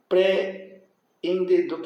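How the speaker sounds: slow "Pré-endedor" with a gap between the two Es; separated vowels. Shown as a demonstration. Teacher showing equal vowels always separate, "Pré-endedor" slowly split. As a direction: break "Pré-endedor" after pré, drawn out. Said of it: "Sempre separam".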